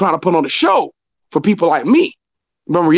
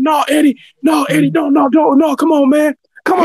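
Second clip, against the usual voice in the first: higher, raised pleading voices; pleading coworkers; 8 semitones higher than usual. Retold or imitated. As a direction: beg him to calm down. Coworkers pulling him back.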